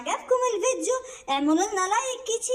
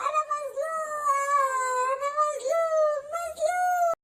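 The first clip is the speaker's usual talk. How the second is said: higher, whining mock sobs of a sly fox; hammed-up self-pity to trick the rabbit; fake crying fox; 5 semitones higher than usual. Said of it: "ما تعيطش".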